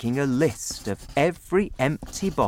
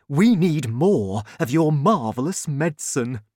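higher, louder, eager delivery; excited father; 4 semitones higher, 5 dB louder than usual. A narrator's reading. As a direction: urge your son on eagerly. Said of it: "he was very excited".

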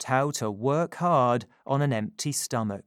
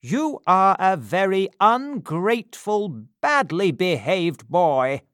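scolding beadle; 6 semitones higher, 6 dB louder than usual. Acted. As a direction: tell him off sternly. Bumble scolds Oliver.

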